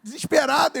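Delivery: high-pitched retold desperation; desperate. Friend back from asking his wife, mock desperate. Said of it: "Aí ele voltou desesperado".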